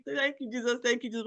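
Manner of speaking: "Thank you, Jesus" pitched high and jubilant; half-hearted retold praise shout; mild mock scream. Mother-in-law hearing the news: high joyful praise. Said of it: "would not stop screaming".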